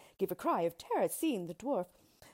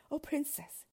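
higher-pitched appeal to a princess; lightly voiced crying out; 8 semitones higher than usual. A narrator's reading. Lover appeals to the angry princess, mildly crying out. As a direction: plead a little, in narrated dialogue. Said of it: "he cried".

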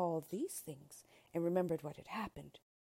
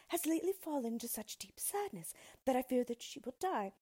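higher-pitched princess voice; troubled young royal explaining herself; earnest princess; 7 semitones higher than usual. A narrator's reading. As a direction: confide a worry in a high, earnest voice.

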